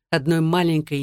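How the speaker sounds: ordinary delivery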